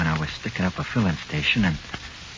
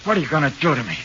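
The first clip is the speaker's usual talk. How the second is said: frightened plea; 8 semitones higher, faster than usual. Acted. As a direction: plead in mounting fear. Suspect led to haunted cell.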